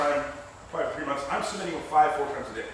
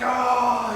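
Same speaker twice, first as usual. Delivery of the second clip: high loud ah; retold yell; 7 semitones higher, 6 dB louder than usual. Kicked again, loud high yelp. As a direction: cry out as if kicked. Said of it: "I'm like".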